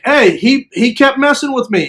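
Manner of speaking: high-pitched self-justifying plea; defensive protest. Retold or imitated. Explains himself to angry group.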